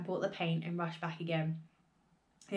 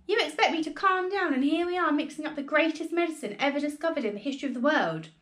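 higher, louder, worked up; father telling his wife off, higher and louder; excited protest; 7 semitones higher, 4 dB louder than usual. A narrator's reading. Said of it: "cried Mister Cranky".

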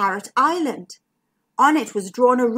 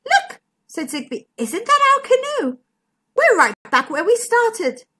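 surprised; 11 semitones higher, 3 dB louder than usual. Acted. Zigbee surprised at canoe.

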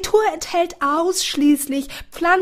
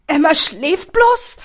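"Emma schläft bloß?" etwas lauter, ungläubig fragend, "bloß" steigend; ungläubige Frage; 5 semitones higher, 7 dB louder than usual. A narrator's reading. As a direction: erleichtert ungläubig nachfragen. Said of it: "Diesmal war es keine Frage mehr". (Jack fragt ungläubig nach, ob seine Schwester nur schläft)

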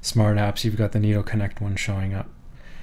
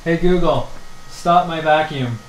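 calling to voice assistant; 6 semitones higher than usual. Real, not acted. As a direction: call it out over the vacuum noise. Commanding assistant over vacuum noise.

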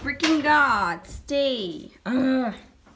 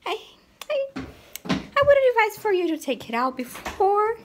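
excited warning; 11 semitones higher than usual. Real, not acted. Warning viewers about loud screaming.

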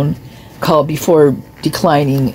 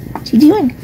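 high cooing pet voice, much higher; talking to the cat; 10 semitones higher than usual. Real, not acted.

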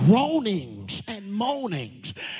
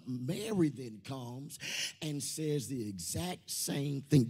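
ordinary talk

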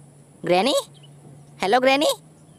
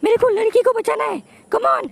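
shrill urgent gamer cry; frantic high yelling; 13 semitones higher, 3 dB louder than usual. Real, not acted. Gamer yelling at the screen to save the girl, frantic. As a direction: yell it high and panicked.

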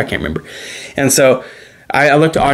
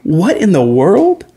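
slow, higher, drawn-out what in the world; amazed disbelief; 4 semitones higher, slower than usual. Retold or imitated. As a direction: let the astonishment stretch the words. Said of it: "I'm like".

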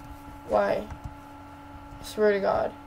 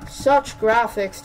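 loud wow, slow mock awe; sarcastic praise; 7 dB louder, much slower than usual. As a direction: fake admiration, dripping irony.

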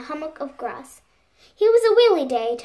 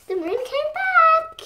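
high and bright, triumphant; joyful climax; 3 dB louder than usual. A narrator's reading. Reader rejoices that the moon is back. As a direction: sing out the return happily.

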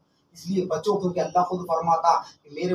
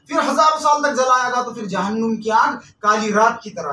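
loud, high-pitched sermon in building refrains; fire-and-brimstone preaching; 5 semitones higher, 6 dB louder than usual. Real, not acted. Preacher warning listeners how hell's fire was kindled, impassioned. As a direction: hammer out each stage of hellfire.

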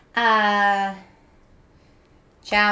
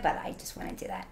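breathy whisper, much softer; whispered aside; 5 semitones lower, 15 dB quieter, faster than usual. Real, not acted. Streamer confides to chat, whispering.

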